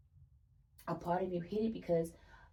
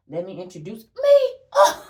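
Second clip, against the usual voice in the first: laughing; 17 dB louder than usual. Real, not acted. Delighted by the card reading.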